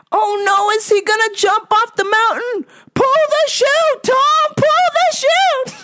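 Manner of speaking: put-on very high, drawn-out falsetto old lady; hammed-up fretful old woman; old lady moviegoer yelling. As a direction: squeal warnings at the movie screen. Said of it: "yelling at the theater".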